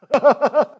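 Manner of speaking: loud, high burst of laughter; amused laugh. Real, not acted. Teller laughing at a childhood memory, amused. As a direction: break out laughing mid-story.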